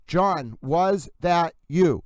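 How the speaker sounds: "was that you" in slow, separate words; drawn-out intonation. Shown as a demonstration. Host spelling out the short phrase, slow and deliberate. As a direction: stretch WAS, THAT, YOU evenly. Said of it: "it's a short phrase".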